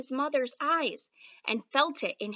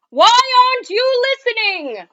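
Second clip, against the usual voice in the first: much higher, louder frustrated yell; exasperated shout; 12 semitones higher, 16 dB louder than usual. Acted. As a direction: shout at the boy who won't listen. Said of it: "shouted the king".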